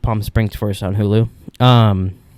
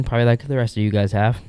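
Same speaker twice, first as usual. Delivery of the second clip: ordinary talk, nothing marked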